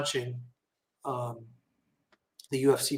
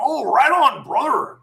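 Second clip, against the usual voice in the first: higher, louder hint of Hulk's hearty brother; Hulk Hogan; 7 semitones higher, 4 dB louder, slower than usual. Hulk Hogan heard in the background, hearty greeting. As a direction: boom it like a wrestler, lightly. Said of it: "I hear in the background".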